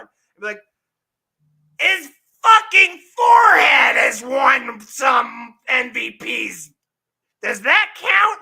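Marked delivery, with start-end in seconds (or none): 1.8-8.4 very slow, high, loud screeching rant; high, slow screeched impression of a game-show guest; Gilbert Gottfried impression. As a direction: strain and wail it like a grating comic. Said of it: "say, like, Gilbert Gottfried and be like".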